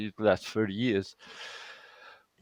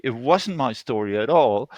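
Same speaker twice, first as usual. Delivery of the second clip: ordinary talk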